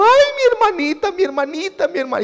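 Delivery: high, wailing mock cry of a little brother; a father imitating his son crying over his hurt sister, high mock wail; imitating a crying boy. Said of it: "y lloraba por su hermanita".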